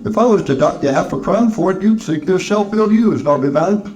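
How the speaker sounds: needling employer. Acted. Scrooge needles his clerk.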